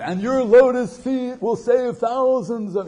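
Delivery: high, loud, hammed-up adoration; high, loud mock flattery of the guru; gushing flattery. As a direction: belt out the mock praise.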